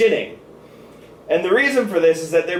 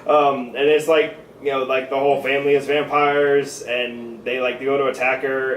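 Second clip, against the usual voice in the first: ordinary talk, nothing marked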